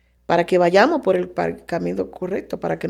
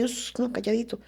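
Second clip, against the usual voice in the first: softly hushed, keeping it secret; hushing; 3 semitones higher, 9 dB quieter than usual. Retold or imitated. People hiding their shame, mock hushing. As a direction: shush it like a secret to hide. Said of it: "prefiere que sea así".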